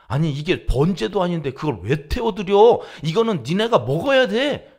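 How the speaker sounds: loud, high scolding rebuke; angry Moses. Retold or imitated. Moses rebuking Aaron's sons, loud angry scolding. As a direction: scold the priests like furious Moses. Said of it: "막 이럴 거예요, 모세가".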